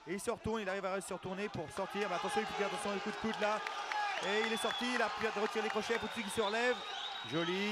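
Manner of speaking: live call of the escape; excited. Real, not acted.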